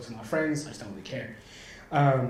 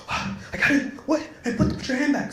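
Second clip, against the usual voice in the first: panicked friend; 11 semitones higher than usual. Retold or imitated. Frightened friend sees hands out of cuffs.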